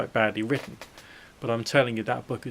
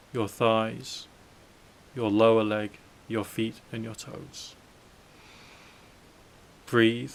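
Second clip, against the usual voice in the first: hypnotic. Acted. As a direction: lull them toward sleep gently. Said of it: "my delightfully hypnotic voice".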